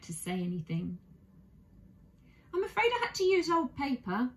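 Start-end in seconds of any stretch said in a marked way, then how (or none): 2.5-4.3 a high, apologetic bear voice; sorry, owning up gently to a small mistake; high-pitched young bear apologising; apologetic little bear. Acted. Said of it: "said Paddington apologetically".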